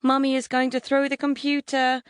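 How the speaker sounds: put-on high little boy's delighted voice; disbelieving joy. A narrator's reading. Four-year-old announcing what mum will do, joyful disbelief. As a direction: marvel in a high, childlike voice. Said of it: "disbelieving joy".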